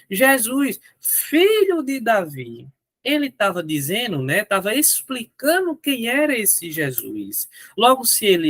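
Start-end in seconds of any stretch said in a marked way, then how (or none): 0.1-2.7 higher, slow, each word laid out; slow explaining. Shown as a demonstration. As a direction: stretch the title out to show it explains. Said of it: "quando ele disse". (devagar, mais agudo, explicando)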